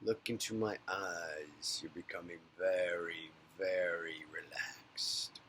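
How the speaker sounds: low, soft, slow hypnotist's whisper. Pretend. Practicing his hypnotist persona on viewers, slow fading whisper. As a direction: lull them into a trance, fading to a whisper. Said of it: "They tell me I have a soothing voice".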